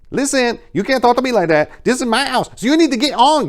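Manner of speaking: high, loud, scolding shout; chastising. A narrator's reading. Gabriella scolding the man at her door, loud and high. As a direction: throw the stranger out of your house. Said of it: "Gabriella chastised".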